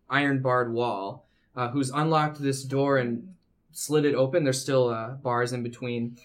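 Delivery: ordinary delivery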